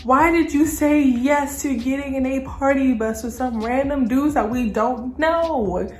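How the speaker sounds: high, loud, exasperated scolding; retold telling-off. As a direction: go off on a friend in replay. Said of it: "start going off on Stacy".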